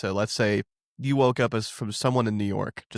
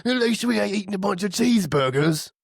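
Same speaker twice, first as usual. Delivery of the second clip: high put-on British accent, laughing through it; mock British retort; 7 semitones higher than usual. Teasing Americans about cheeseburgers: high mock British accent. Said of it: "I'm like".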